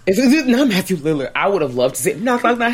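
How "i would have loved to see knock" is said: normal talking voice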